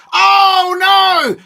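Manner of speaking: high, loud, long-drawn "oh no"; shocked outcry. Real, not acted. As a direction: wail in disbelief at the own goal. Shock at an own goal.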